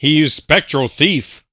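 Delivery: play-by-play announcer. Acted. Announcer calling the battle.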